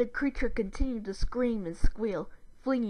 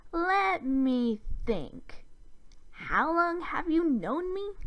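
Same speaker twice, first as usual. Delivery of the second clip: very slow, higher-pitched, drawn-out words; sarcastic; 7 semitones higher, much slower than usual. A narrator's reading. Sister answering her brother's accusation, sarcastic. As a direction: pretend to ponder, mockingly.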